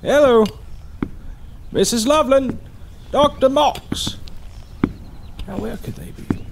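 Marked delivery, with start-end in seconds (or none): 0.0-4.2 calling out. Acted. Doctor arrives, calling through house.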